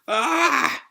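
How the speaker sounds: high pretend scream; drawn-out mock scream; falling cry. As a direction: yelp as if tumbling down. Luffy crashing through the attic.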